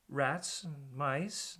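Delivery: slow, soft rat answer; slow dawning realization. Acted. DJ answers Wendover, slowly realizing the danger. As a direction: let it sink in as you say it.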